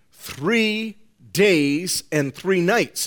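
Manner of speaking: three days and three nights drawn out slowly, each three stressed; emphatic and slow. Shown as a demonstration. Preacher stressing three days and three nights to his congregation. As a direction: dwell on every word of three days and three nights. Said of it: "that this is emphatic".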